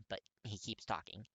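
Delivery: usual speaking voice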